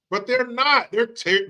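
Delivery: ordinary delivery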